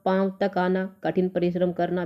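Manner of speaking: ordinary talk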